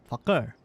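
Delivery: Fakir with a hard K in the middle; deliberate falling Fakir, K fully said; K pronounced. Shown as a demonstration. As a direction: sound out the K in Fakir. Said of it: "that's how I pronounce his name".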